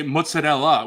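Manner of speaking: mozzarella as "moot-suh-DELL-uh"; hybrid pronunciation. Shown as a demonstration. Co-host showing a hybrid, mozzarella as "moot-suh-DELL-uh". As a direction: blend New York "moot-suh-dell" with a final "uh" in mozzarella. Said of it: "like a hybridization of the two".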